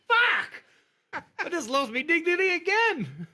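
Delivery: high, loud mock despair; hammed-up exasperated shout; exasperated yell. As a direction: cry out in comic defeat. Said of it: "And I'm like".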